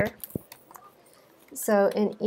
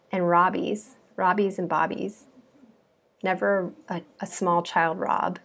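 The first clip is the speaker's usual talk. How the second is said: normal talking voice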